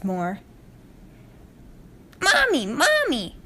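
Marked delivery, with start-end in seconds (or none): 2.2-3.4 put-on high, drawn-out child's call, repeated; filly calling out. A narrator's reading. Filly showing off her flying, high drawn-out call. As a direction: call slowly to make her watch. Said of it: "the filly called out once more".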